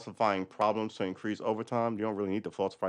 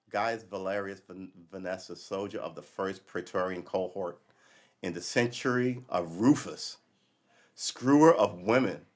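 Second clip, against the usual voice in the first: slow, grand proclamation of an ancient inscription; announcing a Roman soldier's boast; slow formal reading; 4 semitones lower than usual. Shown as a demonstration. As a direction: read it out like a carved epitaph. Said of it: "reading Pompeii graffiti".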